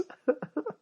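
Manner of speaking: a sudden high burst of laughter; a high laugh at his own joke. Real, not acted. Laugh after Christmas joke.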